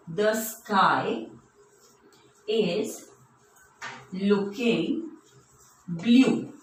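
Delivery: slow, with long pauses between words; word-by-word dictation. Shown as a demonstration. Teacher writing an example for children, slow word-by-word dictation. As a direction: spell out the sentence one word at a time.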